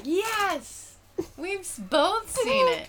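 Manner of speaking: mock triumphant outburst, high and loud; celebrating. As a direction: cry it out as if finally victorious. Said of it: "finally be like".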